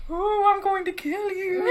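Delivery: high, slow wavering ghost moan; a spooky ghost voice, laughing. Pretend. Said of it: "What does a phantom voice sound like?".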